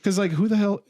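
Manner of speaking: ordinary talk, nothing marked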